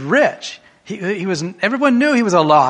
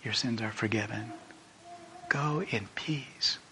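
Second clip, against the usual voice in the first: tender forgiveness; 5 semitones lower, 15 dB quieter than usual. Acted. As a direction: say it like a quiet blessing. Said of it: "looked at her and said".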